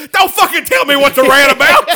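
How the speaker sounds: loud, high laughing retort; imitated snap. Comic shouts at a backstage worker, loud laughing snap. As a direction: shoot back at backstage staff. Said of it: "He's like".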